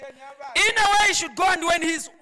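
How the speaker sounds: loud, high scripture proclamation; full-force preaching. Acted. Preacher quoting Proverbs to the congregation, full-force preaching. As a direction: declaim the verse forcefully to the hall.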